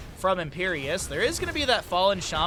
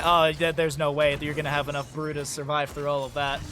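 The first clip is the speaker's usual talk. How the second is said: usual speaking voice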